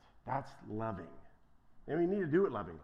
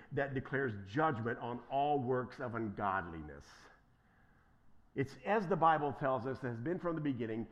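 normal talking voice

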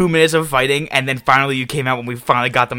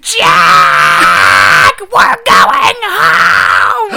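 shrill shouted demand; 4 semitones higher, 8 dB louder, much slower than usual. Retold or imitated. Girlfriend yelling at Jack to leave the fight, high drawn-out shout. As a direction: yell it high and loud, fed up and upset. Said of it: "She goes".

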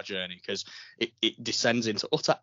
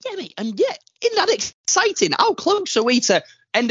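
much higher, louder mock excitement; excited; 8 dB louder than usual. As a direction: squeal it like a kid. Mock excitement about the finale.

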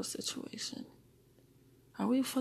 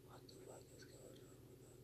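barely audible whisper to oneself; very hushed, voiceless whisper; 27 dB quieter than usual. Real, not acted. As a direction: whisper the question under your breath. Same question repeated in whisper.

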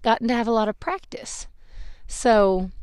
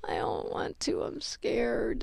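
scared, timid refusal; 13 dB quieter, slower than usual. Retold or imitated. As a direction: shrink away, barely voicing it. Retelling her own scared refusal.

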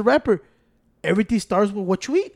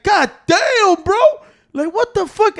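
exasperated; 10 semitones higher, 5 dB louder, slower than usual. Real, not acted. Exasperated rant about doctors.

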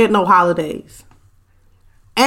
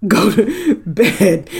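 laughing; much slower than usual. Real, not acted. Laughing while reading comment.

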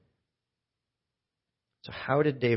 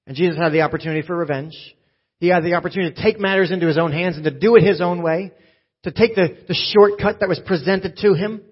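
louder, higher, fast preacher's build; fast, driving preaching; 6 semitones higher, 7 dB louder, faster than usual. Acted. Pastor telling the congregation about Jesus, urgent preach. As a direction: race through it with urgency.

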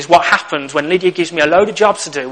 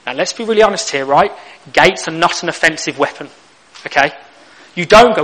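ordinary delivery

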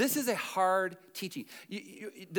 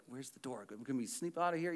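soft, furtive question; mock hushed escape plan; sneaking whisper; 9 semitones lower, 11 dB quieter than usual. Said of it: "they're like".

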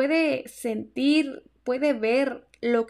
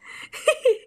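high laugh; 13 semitones higher, 4 dB louder than usual. Real, not acted. Laughs after dad quote.

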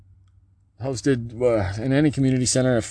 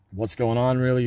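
usual speaking voice

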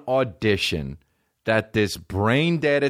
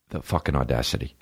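ordinary talk, nothing marked

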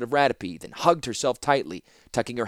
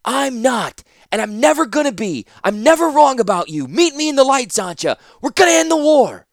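put-on high, eager disbelieving young man; Ratapi's young voice; 10 dB louder than usual. Ratapi urging Zancha to see time changing, high and eager. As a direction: press her, amazed she can't see it.

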